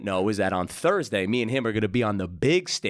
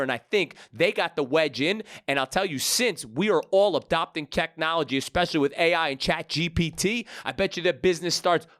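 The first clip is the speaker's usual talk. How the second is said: upbeat, higher announcer-style episode intro; podcast host intro; 7 semitones higher than usual. Acted. Host introducing his guest to the podcast audience, upbeat announcer read. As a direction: hype up the guest for the listeners.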